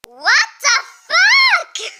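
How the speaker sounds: slow, high, loud laughing what the fuck; baffled and cracking up; laughing loud bewilderment; laughing disbelief. Real, not acted. Baffled reaction at page.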